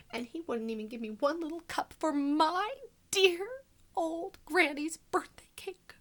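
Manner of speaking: ordinary delivery, nothing marked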